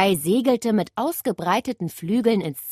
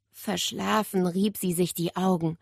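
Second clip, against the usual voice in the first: usual speaking voice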